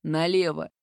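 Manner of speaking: low, loud commanding voice. A narrator's reading. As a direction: give a sharp order. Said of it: "повелительное". (повелительно, низким голосом)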